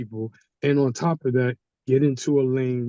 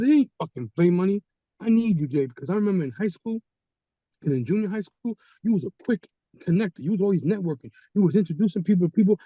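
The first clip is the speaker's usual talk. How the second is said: insistent pitch; 6 semitones higher than usual. Retold or imitated. D pushes Johnny to join.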